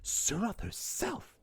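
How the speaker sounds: high, slow, hushed disbelief; Ty Lee gaping at the Seanchan letter; stunned Ty Lee. Retold or imitated. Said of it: "She's like".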